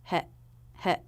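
"He" as a very short heh; brief isolated heh, cut off at once; very quick syllable. Shown as a demonstration. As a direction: say "he" quickly, vowel as in bed. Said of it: "but this is very quick".

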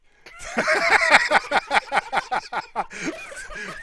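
long, high rattling laugh; high laughter. Real, not acted. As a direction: laugh helplessly before the start. Laughing before the countdown.

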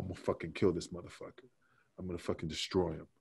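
low, soft retold menace; muttered threat. Angry wrestler to himself while taping up a guitar, muttered threat. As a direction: mutter the threat under your breath.

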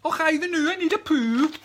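high, hammed-up Scots rhyme; singsong mock Scots, pitched high; put-on Scottish accent. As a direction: play up a silly Scottish accent. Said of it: "that bloody accent come from".

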